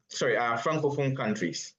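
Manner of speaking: usual speaking voice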